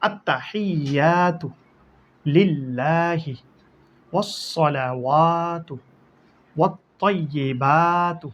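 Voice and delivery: আত্তাহিয়াতু slow and exact, with no extra stretch; correct recitation. Shown as a demonstration. Teacher showing the correct reading after a common mistake, slow and exact. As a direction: model the right way, word by word. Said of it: "এটা টান হবে না".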